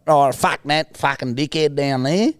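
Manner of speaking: high, drawn-out bogan Australian voice; slow, broad bogan Australian; bogan Australian accent. Acted. Co-host illustrates class differences, broad bogan accent. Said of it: "Bogans, man, like".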